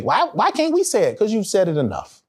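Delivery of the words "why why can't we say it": high-pitched mock complaint; mimicked protest in a high voice. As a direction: imitate a whiny objection, pitched up.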